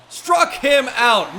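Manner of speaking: builds from a firm call to a huge, slow, shouted "Struck him out"; excited strikeout call. Real, not acted. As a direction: roar the strikeout like a play-by-play caller. Strikeout call, big moment.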